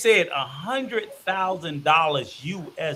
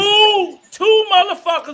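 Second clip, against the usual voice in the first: outraged shout; 10 semitones higher, 6 dB louder than usual. Real, not acted. Outraged counting.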